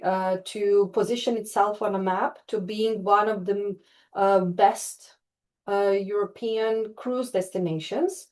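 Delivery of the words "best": ordinary talk, nothing marked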